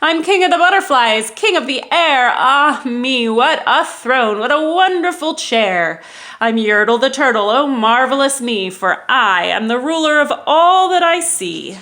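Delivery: loud, gloating turtle king; boastful king. Acted. Yertle boasting from the top of the stack, loud gloating. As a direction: savour every word of self-praise.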